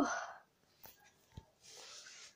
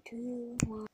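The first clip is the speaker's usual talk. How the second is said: ordinary talk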